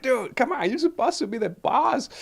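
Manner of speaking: high, incredulous rebuke to a buddy; exasperated scolding. Retold or imitated. Manager scolding his shift leader over bad English, exasperated and high. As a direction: whine it up like you can't believe him.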